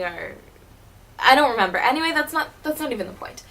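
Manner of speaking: normal talking voice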